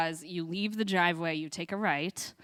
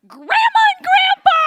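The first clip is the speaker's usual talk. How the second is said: put-on very high, loud frustrated child's shout; exasperated kid yell; 17 dB louder than usual. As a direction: yell it like she's an idiot. Frustrated child yells answer.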